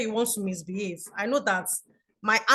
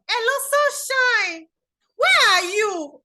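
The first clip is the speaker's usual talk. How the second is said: high, loud, drawn-out demand; mock-upset phone holler, pitched way up; upset caller; 11 semitones higher, 6 dB louder, slower than usual. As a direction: imitate an exasperated friend calling out. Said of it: "She was so upset".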